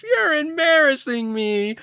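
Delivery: high, drawn-out cartoon whine; slow, high-pitched mock wail; Pearl. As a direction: imitate a mortified teenage cartoon daughter. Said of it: "and Pearl's just".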